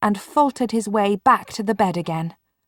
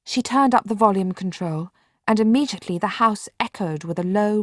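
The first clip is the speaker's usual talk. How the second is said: usual speaking voice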